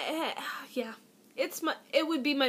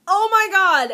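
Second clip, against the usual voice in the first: louder, laughing mock panic; panicked; 6 semitones higher, 9 dB louder than usual. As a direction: blurt it out in mock alarm. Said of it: "I panicked and went".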